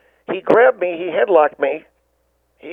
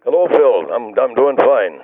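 put-on a lower, slow-paced older man greeting the host; lower caller voice; 7 semitones lower, slower than usual. The new caller Ward greets Phil in a lower, slower voice. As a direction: drop into a second, lower caller character.

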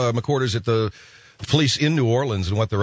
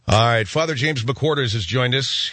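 ordinary talk, nothing marked

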